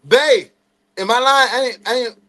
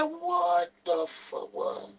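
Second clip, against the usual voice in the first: slow, soft and high in shock; mock stunned whisper of disbelief; 12 semitones higher, 12 dB quieter, much slower than usual. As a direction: let it fall out of you, amazed. Stunned as dad passes.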